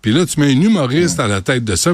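ordinary talk, nothing marked

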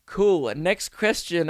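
slow, uninterested reply; a mock bored interviewer moving on; unimpressed brush-off. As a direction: wave him off like nothing he said mattered. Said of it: "and they just went".

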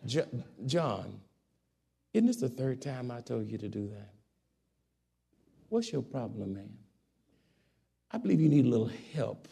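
low, soft and firm; calm soft authority. Shown as a demonstration. Parent calmly correcting son John, soft and firm. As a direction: speak softly but mean business. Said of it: "You talk like that".

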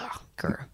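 soft whispered "ugh, grr" of annoyance; whispered frustrated growl. Retold or imitated. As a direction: mutter a frustrated growl under your breath. Retells her anger, mock growl.

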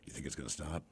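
low hushed voice, frozen with fear; wife hushed and paranoid; paranoid whisper. Retold or imitated. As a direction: whisper as if the phone can hear.

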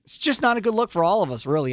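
normal talking voice

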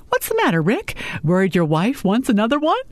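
high, swooping mock concern; teasing taunt. A narrator's reading. Sophie pushing her boss too far, cheekily teasing. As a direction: needle him with rising questions.

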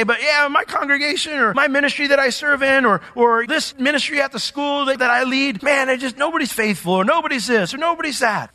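mock the worn-out leader's whine; whiny leader. A pastor voicing a frustrated leader, mock whine. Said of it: "you say".

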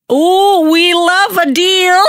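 a gleeful squeal of a laugh; excited, high. Real, not acted. Reacts to the promo codes.

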